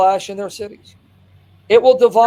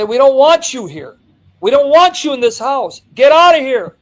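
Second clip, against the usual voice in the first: angry family shout; 6 semitones higher, 6 dB louder than usual. Retold or imitated. Family throwing father out.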